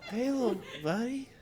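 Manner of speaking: high, sweet pet voice; high coaxing voice to a hungry cat; talking to cat. Real, not acted. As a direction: coo to a little animal.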